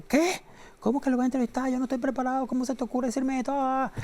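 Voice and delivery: high-pitched acted panic; panicked protest. Startled interviewer to assistant who sprang Spielberg on him, acted panic. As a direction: protest in a high, nervous flurry. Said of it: "yo me puse muy nervioso".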